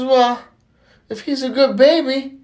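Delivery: put-on high, loud childlike challenge; indignant little boy. Little Johnny confronting the pregnant lady, high incredulous kid. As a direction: build to a high, incredulous question.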